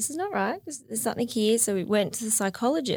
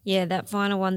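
ordinary talk

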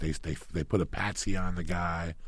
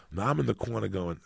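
normal talking voice

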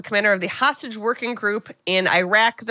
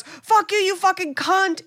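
much higher mock yell, slower on the insult; retold angry scream; 9 semitones higher, slower than usual. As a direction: imitate him losing it at her on the phone. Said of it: "starts screaming at her".

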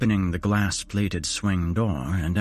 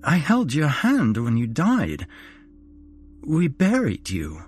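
higher, halting sobbing son; spluttering between sobs; 6 semitones higher, slower than usual. A narrator's reading. Son confronting his dead mother, spluttering between sobs. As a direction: choke the words out through tears. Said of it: "I spluttered between sobs".

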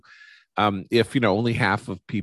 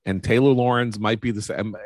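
ordinary delivery

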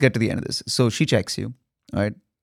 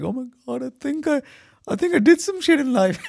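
high, breathless amazement at her own success; overwhelmed joy; 9 semitones higher than usual. Retold or imitated. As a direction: gush like you can't believe it. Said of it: "I was like".

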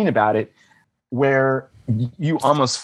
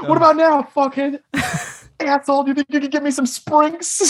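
much higher, hammed-up furious taunt; hammed-up furious taunt at the server; mock rage; 13 semitones higher than usual. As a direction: pretend revenge on the server, hammed-up yelling. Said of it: "I'm angry".

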